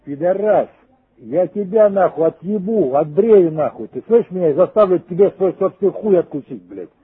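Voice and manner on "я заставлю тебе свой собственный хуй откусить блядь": ordinary talk, nothing marked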